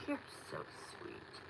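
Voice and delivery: soft breathy whisper of thanks. Real, not acted. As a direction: whisper it to yourself. Repeats the thanks under her breath.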